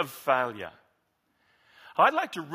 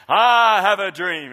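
slow, louder, dream held long; Martin Luther King accent; 3 semitones higher, 10 dB louder, slower than usual. Acted. A pastor ending his recitation with a Southern preacher flourish. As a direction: finish on the famous line, slowly. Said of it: "I just want to do his accent".

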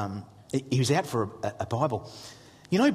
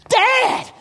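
put-on high, loud kid calling Dad; mock excited yell; excited son; 9 dB louder than usual. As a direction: imitate a boy bursting with news. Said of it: "he's reading through and he goes".

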